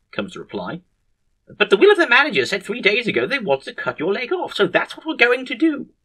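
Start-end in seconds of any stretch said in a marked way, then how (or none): 1.5-5.9 high-pitched, emphatic argument; insistent objection. Acted. Head surgeon overruling the patient's wish, high insistent voice. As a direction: argue it firmly in a raised pitch.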